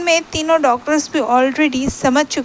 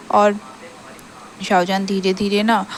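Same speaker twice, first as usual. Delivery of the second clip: ordinary delivery, nothing marked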